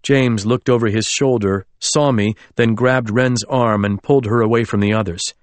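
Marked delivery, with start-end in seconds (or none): none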